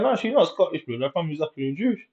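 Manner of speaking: high, quick insistent correction; mate insisting. Retold or imitated. Mate correcting you about the girl, high and fast. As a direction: set him straight, fast. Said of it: "He's like".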